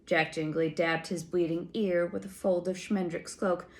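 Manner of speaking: ordinary delivery, nothing marked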